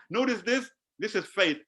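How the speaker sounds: ordinary delivery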